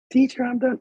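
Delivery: put-on high-pitched eager kid voice; mock child announcing they've finished; imitated pupil. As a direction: call out to the teacher like a little student. Voicing a student finishing.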